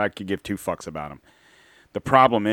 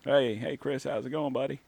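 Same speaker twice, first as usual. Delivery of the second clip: softer, lightly voiced Bill guest; 6 semitones higher, 6 dB quieter than usual. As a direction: play the folksy guest Bill. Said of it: "I have a guest".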